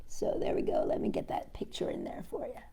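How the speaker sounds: soft unvoiced aside; whisper. Real, not acted. Reader whispering an aside to the kids, hushed. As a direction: murmur it under your breath.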